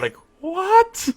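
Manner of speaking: very high, squealed astonishment; mock disbelief. Reacting to "not Scottish enough", high incredulous squeal. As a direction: shoot up high in comic disbelief.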